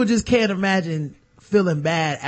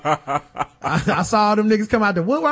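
ordinary delivery

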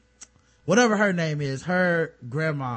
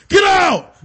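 much higher and louder yelled command; hammed-up roar; mock shout; 13 semitones higher, 11 dB louder than usual. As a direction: yell it hard. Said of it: "they open the door and be like".

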